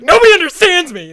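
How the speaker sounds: loud high despairing yell. Retold or imitated. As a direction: wail it out and run. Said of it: "And Octar's like".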